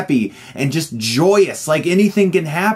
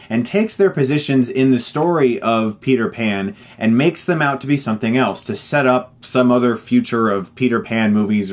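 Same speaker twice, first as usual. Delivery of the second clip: normal talking voice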